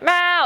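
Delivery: high demanding meow; short high cat cry; imitated cat meow. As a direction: meow like a vocal cat nagging. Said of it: "my cat just screams".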